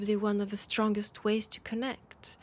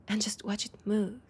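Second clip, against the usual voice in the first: usual speaking voice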